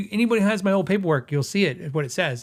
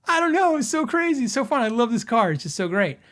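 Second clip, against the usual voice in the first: giddy; 7 semitones higher than usual. Retold or imitated. Husband gushing to wife about his silly car, high and excited. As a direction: gush about the car like a kid.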